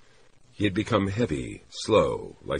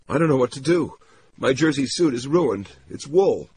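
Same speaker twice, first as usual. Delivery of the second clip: high, loud voice of a woman at a loss; high-pitched, distraught heroine; distressed woman character; 10 semitones higher, 6 dB louder than usual. Acted. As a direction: pitch it high and helpless, upset over a mishap.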